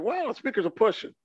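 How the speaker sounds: high, quick wow of surprise; hurried, high-pitched amazement; amazed. Retold or imitated. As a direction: retell being impressed, pitch jumping up. Retold amazement at speakers.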